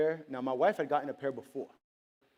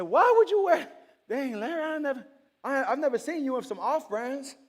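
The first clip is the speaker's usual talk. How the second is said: high-pitched comic disbelief; high, incredulous protest; acted incredulity; 9 semitones higher, 4 dB louder than usual. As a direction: scold a friend in mock amazement. Acts disbelief at friend's off brands.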